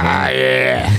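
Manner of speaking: low gruff tough-guy grunt. Pretend. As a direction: drop it deep like a thug. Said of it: "Trying to be a tough guy again".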